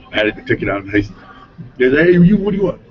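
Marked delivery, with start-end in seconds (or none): 1.8-2.8 high, loud barked challenge at intruders; retold loud challenge. Sheriff confronting the men in his retold story, loud and high. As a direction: call them out sharp and high.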